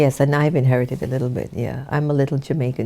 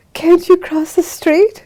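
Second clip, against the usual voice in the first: mock-puzzled, pitched up high; teasing question; 7 dB louder than usual. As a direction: play the amused sister, higher. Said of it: "Sister Gita just looking at me like".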